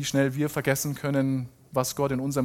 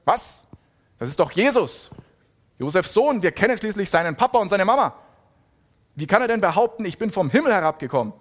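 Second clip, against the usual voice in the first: louder, higher, rushed outrage; indignant; 6 semitones higher, 5 dB louder than usual. A narrator's reading. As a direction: protest in acted disbelief. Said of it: "riefen die Juden empört". (gespielt empörter Ausruf)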